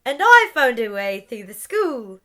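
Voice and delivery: over-enthusiastic. Acted. Scorpius trying to join in, over-enthusiastic. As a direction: barge in too eagerly. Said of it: "over-enthusiastic".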